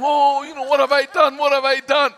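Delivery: loud, high hammed-up fret; lightly mock God wringing his hands; sarcastic imitation of a worried God; mock panicked God. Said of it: "God is trembling in his boots and saying".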